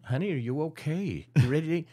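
high-pitched, lightly voiced concerned wife; solicitous wife. Wife checking on her silent husband, solicitous.

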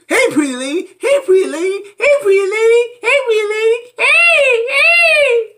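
high, building to a loud squeal; mock shrieking dad. Vlogger exaggerating Michael calling his daughter, rising squeals. Escalating mocking repeat.

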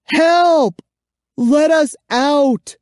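high, loud desperate yell; frantic high shout; shouting for help. A narrator's reading. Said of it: "I shouted".

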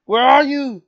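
loud, high call. Real, not acted. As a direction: call out to find someone. Calling out to find teammate.

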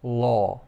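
"law" ending on an open vowel, no w glide. Shown as a demonstration. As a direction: show "law" with no w at the end. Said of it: "a tutaj chodzi o prawo".

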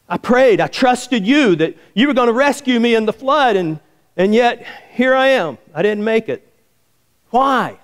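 high, indignant protest to God; mock-wounded appeal; acted aggrieved complaint. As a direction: plead your case, pitched well up. Dead man complains to God.

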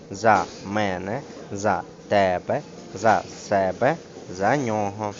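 ТОГО, МЕНЕ, ТЕБЕ, НЬОГО stressed on their first syllable after the preposition; measured list, beat on the pronoun's first syllable. Shown as a demonstration. Teacher drilling pronouns with prepositions, stress shifted forward. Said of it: "наголошується перший склад".

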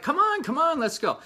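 high, loud, impatient urging; voicing Chad eager to ride off fishing. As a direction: hurry him along like a kid raring to go. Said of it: "He's like".

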